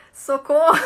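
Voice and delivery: loud joking shout; mock cry for help. Teacher pretending to panic in front of students. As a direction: yell help in play. Joking after hard twister.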